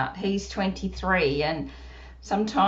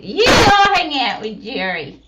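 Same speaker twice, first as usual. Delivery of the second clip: put-on puppet child voice; 4 semitones higher, 8 dB louder than usual. Puppet Susie speaking.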